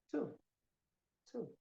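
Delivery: "Two" cut short with a hard t; separate, brief models of "Two", no ch; dry sound. Shown as a demonstration. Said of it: "é seco o som".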